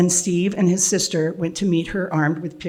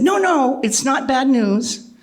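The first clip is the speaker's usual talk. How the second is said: eager reassurance; 7 semitones higher, slower than usual. Retold or imitated. Reassuring scared daughter on phone.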